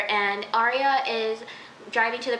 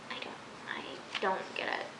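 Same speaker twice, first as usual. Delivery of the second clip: baffled whisper; 4 semitones lower, 11 dB quieter than usual. Real, not acted. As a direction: whisper it, at a loss. Baffled by a character.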